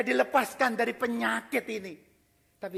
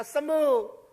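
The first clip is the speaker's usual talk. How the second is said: commanding word; 7 semitones higher, 6 dB louder than usual. Pretend. God's one-word healing command.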